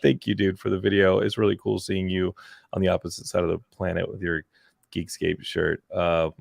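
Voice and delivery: ordinary delivery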